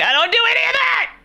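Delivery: fast, very high-pitched squeal of objection; exasperated protest. Retold or imitated. Acting out her exasperated objection.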